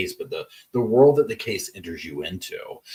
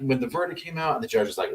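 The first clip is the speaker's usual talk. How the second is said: usual speaking voice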